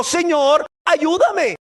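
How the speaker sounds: high-pitched call for help; acted mother's appeal; pleading cry. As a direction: beg with the voice lifted. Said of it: "imagínate una madre clamándole".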